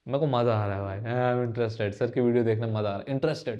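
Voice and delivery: low, soft voice of an absorbed student; lightly acted interested student. Student enjoying sir's video, lightly acted low contented murmur. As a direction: lightly play a student quietly savouring the lesson.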